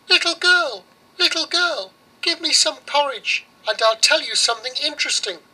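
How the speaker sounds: very high squeaky mouse. Acted. Mouse begs the girl for porridge, very high.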